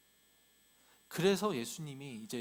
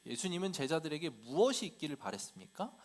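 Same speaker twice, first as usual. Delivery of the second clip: usual speaking voice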